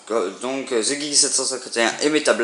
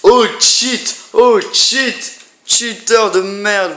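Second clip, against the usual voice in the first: high, loud teasing accusation; mock outrage; 8 semitones higher, 5 dB louder, much slower than usual. Real, not acted. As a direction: jeer at him loudly. Mock accusing friend of cheating. (moquerie indignée et forte)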